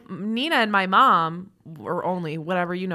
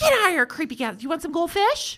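higher, sing-song mom talking to a stranger; 9 semitones higher than usual. Pretend. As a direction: talk to him like he's a toddler. Talking to the creepy man.

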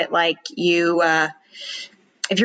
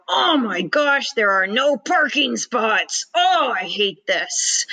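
slow, exasperated griping, higher; angry complaint; 3 semitones higher, slower than usual. Retold or imitated. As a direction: whine angrily about parking. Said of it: "The person who's motivated by anger".

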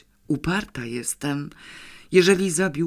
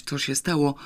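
plain reading voice

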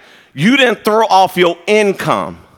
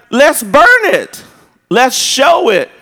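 rallying call; 7 semitones higher, 5 dB louder, slower than usual. Retold or imitated. Youth leaders rallying.

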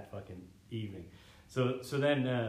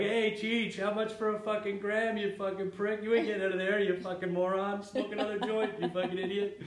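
high, louder jeering cop voice; mocking cops; 10 semitones higher, 5 dB louder than usual. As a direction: taunt a stoned prisoner like a smug cop. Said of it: "the cops are making fun of me".

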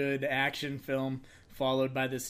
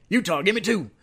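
movie quote impression; 9 semitones higher, 9 dB louder, faster than usual.

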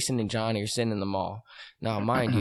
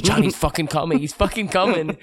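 higher and louder, urgent warning; urgent alarm; 7 semitones higher, 6 dB louder than usual. Retold or imitated. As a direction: warn them he's coming. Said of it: "and he says".

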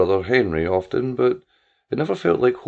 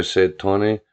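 plain reading voice

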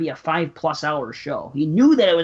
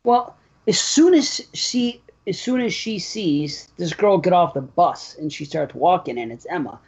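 ordinary talk

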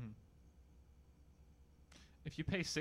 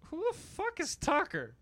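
loud, high disbelief; shocked outburst, pitch way up; incredulous; 7 dB louder than usual. Real, not acted. Baffled outburst at excuse.

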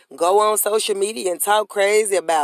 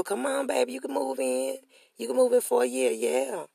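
soft, sweet mock offer; 4 semitones higher, 6 dB quieter than usual.

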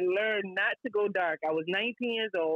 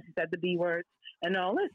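normal talking voice

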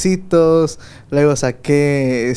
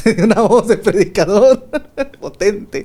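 higher, words breaking into laughter; laughing; 5 semitones higher than usual. Real, not acted. Host teasing the guest about his preacher voice, laughing. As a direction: tease with a real laugh bubbling through.

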